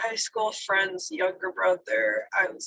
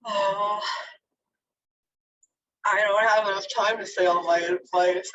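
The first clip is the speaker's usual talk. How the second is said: normal talking voice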